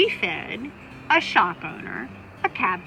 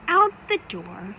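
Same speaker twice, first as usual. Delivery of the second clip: ordinary delivery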